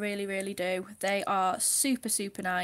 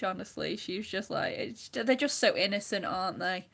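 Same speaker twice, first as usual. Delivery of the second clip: ordinary talk, nothing marked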